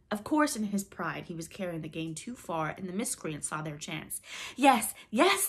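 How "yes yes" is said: high, loud, keen answer; eager. A narrator's reading. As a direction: answer eagerly, hungry to hear more. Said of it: "they answered eagerly".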